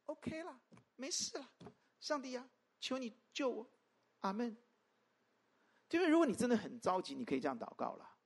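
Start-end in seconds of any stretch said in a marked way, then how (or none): 0.0-4.6 1 mock offhand prayer. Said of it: "我很害怕那种祷告".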